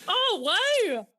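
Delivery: high-pitched, impressed wow; real amazement. Real, not acted. Friend hearing about effects in the cinema seats, amazed. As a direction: react with genuine astonishment.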